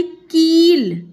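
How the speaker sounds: कील with the ई stretched long; long ee in kīl. Shown as a demonstration. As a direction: draw out the vowel in कील. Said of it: "खींच के बोल रहे हैं ना".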